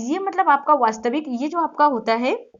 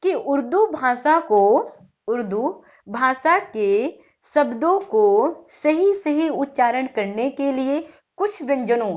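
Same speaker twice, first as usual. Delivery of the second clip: slow dictation, words drawn out; teacher dictating a definition in measured chunks; slower than usual. Shown as a demonstration. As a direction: read it out slowly so students can write. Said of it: "आप थोड़ा सा लिख लीजिए definition में".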